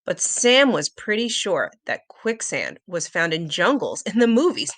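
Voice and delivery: plain reading voice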